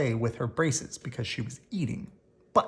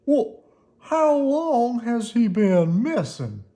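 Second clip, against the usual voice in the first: put-on old man voice, slow; 9 semitones higher, 6 dB louder, slower than usual. A narrator's reading. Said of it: "asked Mister Hollander".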